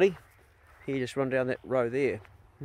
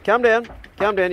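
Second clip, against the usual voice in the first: raised, firm calm down to a dog; loud firm command; 7 semitones higher, 8 dB louder than usual. Real, not acted. Owner shouting at scrapping dogs to calm down, loud and firm. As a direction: bark it out to settle the dogs.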